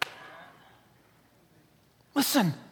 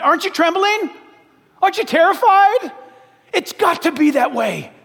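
rising urgency; 6 semitones higher than usual. Real, not acted. Preacher pressing the congregation.

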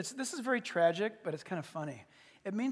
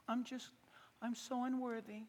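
small, soft, self-effacing voice; humble Saul; 11 dB quieter than usual. Acted. Young King Saul feels unworthy, soft humble voice. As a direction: shrink back quietly in modesty.

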